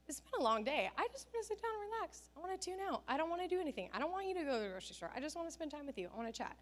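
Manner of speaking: a hint of a worn-out husband begging to stay in; husband's tired pleading. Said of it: "Ben was just like".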